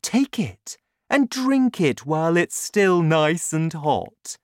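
sweetly persuasive; sweet, smiling. A narrator's reading. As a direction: urge her with a smile. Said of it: "he smiled sweetly".

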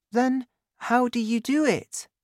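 put-on very high child's question; high-pitched childlike curiosity; curious boy. A narrator's reading. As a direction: ask Dad as an inquisitive boy. Boy questions his father.

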